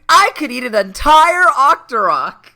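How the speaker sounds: cheesy king voice. Retold or imitated. Continuing the CD-i king bit.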